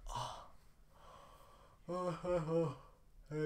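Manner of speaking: soft weary drawn-out groans; tired groans. Real, not acted. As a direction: groan quietly, worn out. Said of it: "疲れてきた". (疲れたうめき声)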